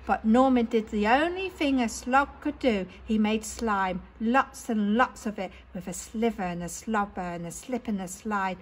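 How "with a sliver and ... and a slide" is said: ordinary delivery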